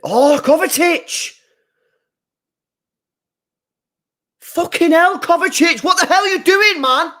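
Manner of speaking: screaming in real outrage at the player; raging high yell at the screen; furious shout. Real, not acted. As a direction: bellow it, high and loud, at your own player. Furious at his player's foul.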